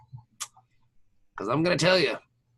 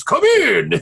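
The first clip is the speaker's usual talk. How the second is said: higher, louder imitated call; mock call to enter; 7 semitones higher, 6 dB louder than usual. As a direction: call out to someone at the door, hammed up. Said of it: "he goes".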